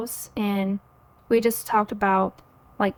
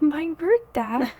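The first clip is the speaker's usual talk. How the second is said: higher, slowly drawn-out astonished question; mock-stunned disbelief; 6 semitones higher, slower than usual. Retelling news of her birth dad's call, stunned disbelief. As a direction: re-enact being floored by the news. Reacting to dad wanting to talk.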